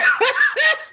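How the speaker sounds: high, loud cackle; real burst of laughter. Real, not acted. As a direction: crack up at your own joke. Cracks up at own remark.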